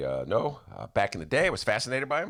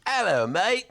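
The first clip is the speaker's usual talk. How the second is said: put-on British accent; 10 semitones higher, 5 dB louder, slower than usual. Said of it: "it doesn't sound like that".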